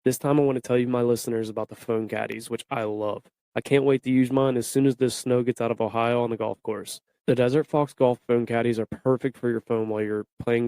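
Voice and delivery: even, slightly low podcast ad read; sponsor ad read. Acted. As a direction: read the sponsor copy smoothly and steadily. Recorded-style sponsor ad read.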